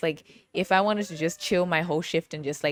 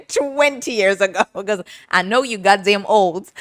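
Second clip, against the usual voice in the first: laughing roast; 3 semitones higher, 6 dB louder than usual. Real, not acted. Roasting the boss about his age, laughing. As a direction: crack up while ribbing someone.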